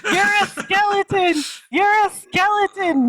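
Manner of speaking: very high, hammed-up raving; mock hallucinating freak-out. Drugged-out Luke raving at his uncle, hammed-up freak-out. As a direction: cry out high like a comic madman.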